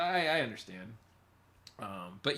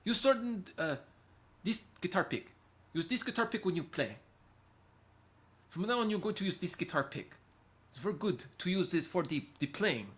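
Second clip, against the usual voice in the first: higher-pitched attempt at a South African accent; 7 semitones higher than usual. Shown as a demonstration. Streamer instructing on a guitar pick, South African accent. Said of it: "I can do a South African one".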